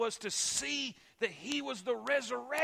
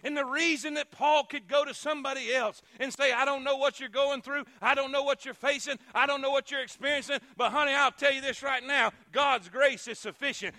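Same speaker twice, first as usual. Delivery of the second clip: loud, higher preaching voice; 4 semitones higher, 7 dB louder than usual. Acted. Preacher telling the hurting congregation God's grace suffices, loudly. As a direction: press the promise home to the crowd.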